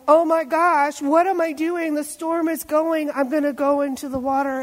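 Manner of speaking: rising alarm as he sinks; panicked Peter. Retold or imitated. Peter looking down at the water, panicking loudly. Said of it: "I'm like looking down and realizing".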